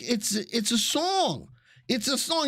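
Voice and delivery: high-pitched defensive plea; retold protest. Son defending himself to his dad, high mock protest.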